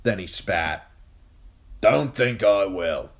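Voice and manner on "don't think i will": put-on gruff old man. Yoren refuses.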